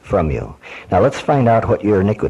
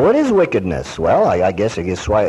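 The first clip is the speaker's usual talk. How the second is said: usual speaking voice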